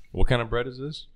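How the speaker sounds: usual speaking voice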